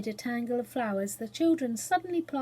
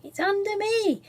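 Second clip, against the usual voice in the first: high, bright, cheerful reply; the cook pleased with her cool bath; high cheerful answer; happily; 9 semitones higher, 4 dB louder than usual. A narrator's reading. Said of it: "replied the cook happily".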